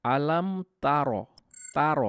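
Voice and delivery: তার with a light তা, slow; তার with an unheavy তা. Shown as a demonstration. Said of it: "তা দিয়ে বলছি".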